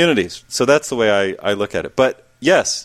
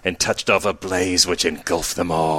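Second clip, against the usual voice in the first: movie trailer narrator; 3 semitones lower than usual. Pretend. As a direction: intone it like a film prologue. Reciting Mad Max narration.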